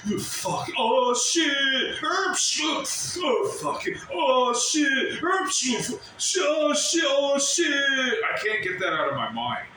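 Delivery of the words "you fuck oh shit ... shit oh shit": echoing mock yells. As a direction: replay the crowd's yelling in his head. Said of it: "all I hear is a".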